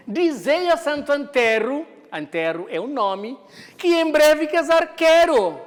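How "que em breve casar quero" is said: higher and louder, reciting the line of verse; verse read aloud. Shown as a demonstration. Teacher reciting a verse for the students, louder and higher. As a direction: declaim the poem line to the class.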